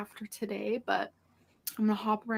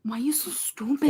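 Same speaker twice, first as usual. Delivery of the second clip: whispered self-scold; 3 semitones higher, 3 dB louder than usual. Real, not acted. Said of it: "fake Mexican accent".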